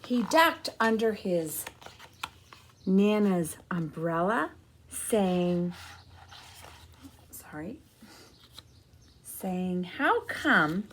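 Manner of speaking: put-on slow, gentle read-aloud for kids; storybook narration. A narrator's reading. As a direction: tell the story to a room of children. Reading picture book to children.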